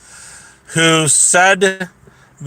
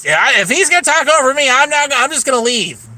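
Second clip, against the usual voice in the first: raised-pitch mimicry of a huffy woman; higher mock-huffy quote; mocking imitation; 6 semitones higher than usual. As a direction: imitate someone announcing they're storming off. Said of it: "And she's like".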